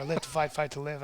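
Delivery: low, soft hero motto; mock action hero. Hero among scared civilians, low mock-solemn voice. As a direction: intone it low and quiet, play-acting.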